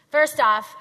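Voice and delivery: "First off" loud and high, punched on "first"; emphatic first off. Shown as a demonstration. Coach showing the soulful way to say "first off", loud. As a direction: belt it out with flair. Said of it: "share my African blood".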